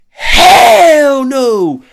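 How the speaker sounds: drawn-out, high-pitched scream; full-volume retold shriek; screamed refusal. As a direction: scream it out in terror, stretching the first word. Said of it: "I screamed".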